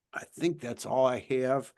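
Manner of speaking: ordinary talk, nothing marked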